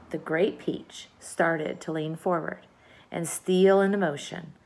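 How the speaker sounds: ordinary delivery, nothing marked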